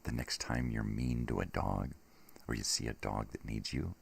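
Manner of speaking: ordinary talk, nothing marked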